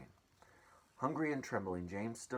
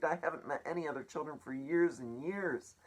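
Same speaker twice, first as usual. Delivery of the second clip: put-on pleading boy; 7 semitones higher, 4 dB louder than usual. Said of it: "he had begged".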